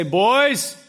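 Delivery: dad voice. Shown as a demonstration. Dad calling his kids from the kitchen, loud dad voice. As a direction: call the boys from another room. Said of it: "That's my dad voice a little bit".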